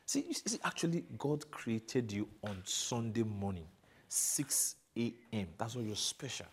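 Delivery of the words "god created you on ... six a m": slow, savoring every word; slow and deliberate. Acted. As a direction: draw it out to charm her. Flattering girlfriend, slow for effect.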